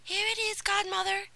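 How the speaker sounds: put-on high, breathless eager girl; panting Cinderella handing it over. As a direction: answer as if out of breath from running. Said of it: "said Cinderella breathlessly".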